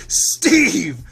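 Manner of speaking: high, slow, hammed-up scolding; mock the prude snapping from the front seat; front-seat passenger scolding Steve in a high mock outrage; outraged scold. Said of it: "it's like".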